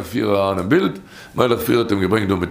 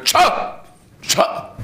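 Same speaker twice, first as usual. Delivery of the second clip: hissed Tscha, high and voiceless; stern whispered hush; sharp shushing. Retold or imitated. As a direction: silence a singing woman with a sharp Tscha. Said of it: "sie hört nicht kein Tscha".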